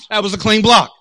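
retold heated comeback; insistent retort. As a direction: drive the point home in an argument. Acted quarrel at ball game.

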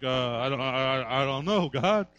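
low, soft, halting stammer; a small man caught out, stumbling over every word; timid stammer. Acted. Job answers God, low soft stammering.

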